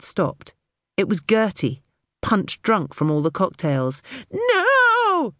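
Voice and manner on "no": shrieked high no; screaming. A narrator's reading. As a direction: scream it in panic. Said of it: "she was screaming".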